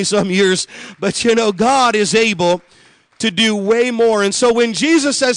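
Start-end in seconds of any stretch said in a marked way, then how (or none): none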